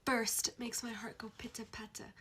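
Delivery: breathy swooning whisper. Real, not acted. Fan confiding to viewers, soft swooning whisper. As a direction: whisper it like a secret crush. Swooning over a romance trope.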